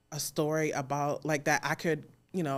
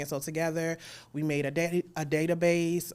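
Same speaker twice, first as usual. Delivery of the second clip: usual speaking voice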